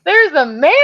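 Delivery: high, drawn-out scandalized cry; hammed-up horror at an intruder; mock shocked shriek. Babysitter spots a man in women's house, shocked shriek.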